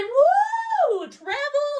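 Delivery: very high whooping yell; excited cheer. Retold or imitated. New hires cheering their jobs, high whooping yell. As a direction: whoop it out like you just won.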